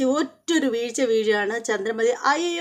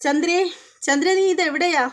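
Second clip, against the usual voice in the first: raised, higher call across the house; mock call for his wife from the doorway; 6 semitones higher than usual. Husband calling wife from the wet floor, raised call. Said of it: "ചന്ദ്രമതിയെ വിളിക്കുകയാണ്".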